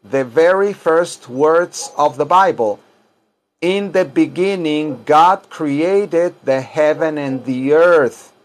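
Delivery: slow, deliberate model sentence; measured language-class modelling; slow teaching diction. Shown as a demonstration. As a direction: say each English word slowly for learners. Teacher models English slowly.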